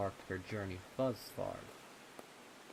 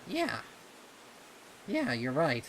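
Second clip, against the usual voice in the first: put-on young colt voice; 7 semitones higher, 8 dB louder than usual. Voices Snips.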